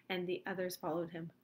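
ordinary delivery

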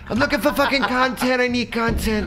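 high-pitched mock demand; squeaky hammed-up thug voice; menacing high voice. Continuing knife threat bit.

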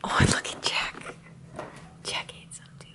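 whispering. Real, not acted. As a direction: hush it, sleepy early morning. Early Christmas morning, hushed voices.

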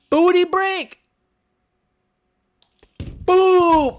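very high, loud yell; squealed attack call; battle cry. Pretend. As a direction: shout your silly finishing move. Battle cry before a hit.

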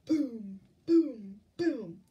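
loud, separate falling booms; booming footsteps. A narrator's reading. Reader sounds the giant's approach, loud booms. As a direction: punch each boom like a heavy footfall.

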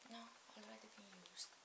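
quiet whispered aside; whispering. Real, not acted. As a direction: whisper it off to yourself. Rejecting name idea.

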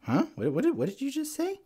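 very high, squeaky mock confusion; startled disbelief. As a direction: ask it in high-pitched bewilderment. Retold startled reaction to dad.

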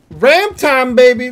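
loud high whoop; hype yell. Real, not acted. Streamer cheering to chat about the ram, excited yell. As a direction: cheer it like a win.

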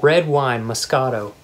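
usual speaking voice

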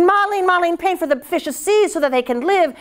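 high, quick, greedy urgency; eager emperor. A narrator's reading. Greedy emperor urging Ma Lin, high and eager. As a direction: hurry him with grasping excitement.

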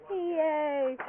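ordinary talk

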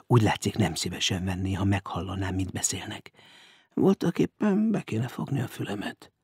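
soft, private aside; muttering to himself. A narrator's reading. Stranger eavesdrops from under the bed, muttering. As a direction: murmur thoughts under the breath. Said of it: "mondta magában a szemtelen idegen".

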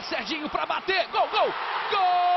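rising from a call to a high goal yell; building goal shout. Real, not acted. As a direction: climb into the goal scream.

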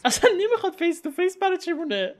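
high fast scared whisper, nearly laughing; panicked. Real, not acted. Gamer spooked by a ghost, panicked high whisper.

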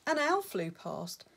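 plain reading voice

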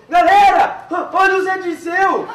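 loud call across a crowd; shouting. Retold or imitated. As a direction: yell it out to the crowd. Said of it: "Então ele gritou".

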